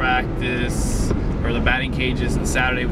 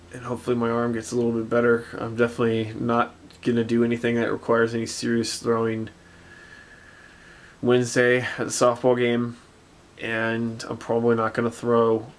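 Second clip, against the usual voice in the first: normal talking voice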